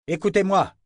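appel fort et haut pour capter la foule; crié. Acted. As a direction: crie par-dessus la foule. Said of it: "cria le sourcier en levant les bras". (cri pour réclamer l'attention)

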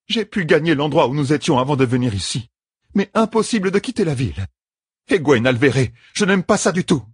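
high-pitched, troubled report; uneasy matriarch. A narrator's reading. Matriarch reporting to the leader that escape fails, uneasy. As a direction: report back as a worried elder.

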